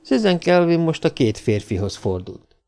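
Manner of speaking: ordinary delivery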